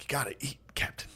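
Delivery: whispered, comic nudge to eat; soft, breath-only mock pleading; mock hushed urging. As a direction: whisper it as an imitated crewman. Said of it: "He's like".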